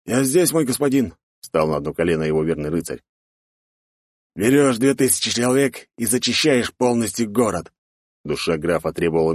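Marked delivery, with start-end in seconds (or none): 0.0-1.1 higher, eager servant's reply; loyal knight. Acted. Knight kneels before the count, higher dutiful reply. 4.4-7.7 raised, forceful order; commanding count. Acted. Count orders his knight to take revenge, commanding voice.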